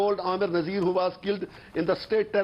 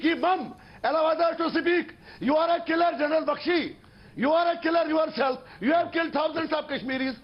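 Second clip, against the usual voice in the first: shouting him down on live TV; angry accusation; 5 semitones higher than usual. Real, not acted. Panelist shouting down General Bakshi.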